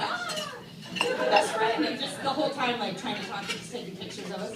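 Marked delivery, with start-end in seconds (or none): 0.0-1.8 high, breathless squeal of delight; gushing squeal. Pretend. Fan to Oprah, high squealing delight. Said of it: "I'd be like".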